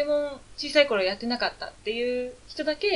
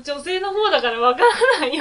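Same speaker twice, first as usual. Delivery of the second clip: laughing; 4 semitones higher, 7 dB louder than usual. Real, not acted. Flustered over pronunciation.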